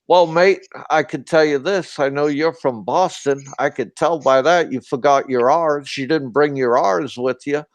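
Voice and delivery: retelling in a mild mock-Australian lilt; imitated Australian. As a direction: play the Aussie bar owner teasing, accent lightly put on. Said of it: "I heard this guy speaking real strangely".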